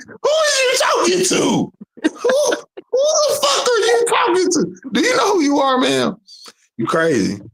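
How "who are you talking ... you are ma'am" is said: very high, shouted mock outrage through laughs; hammed-up outrage with laughter. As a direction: shriek it in hammed-up disbelief.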